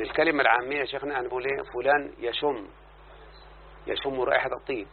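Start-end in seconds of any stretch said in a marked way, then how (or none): none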